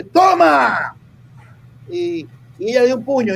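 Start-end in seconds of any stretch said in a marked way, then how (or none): 0.0-0.9 "¡Toma!" barked out loud and higher; hammed-up shout of triumph; mock shout. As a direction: yell it like you're the one hitting. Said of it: "usted dice".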